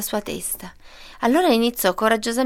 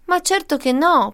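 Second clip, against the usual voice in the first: higher-pitched mild protest; 7 semitones higher than usual. A narrator's reading. Tied-up wolf answers mother goat, protesting. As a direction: object with a raised pitch. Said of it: "protestò Lupo".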